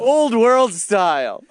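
slow tagline, commercial voiceover; ad announcer. Pretend. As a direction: savor the slogan slowly. Parody Prego commercial tagline.

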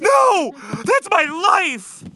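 high, loud cry of protest; mock outraged yell. Real, not acted. Host yells in protest at cohost: loud, high mock outrage. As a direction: shriek it like your life depends on it.

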